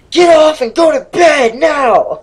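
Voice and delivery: high, loud, slow mom yell. Retold or imitated. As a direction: bellow it like a furious mother. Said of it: "it's like".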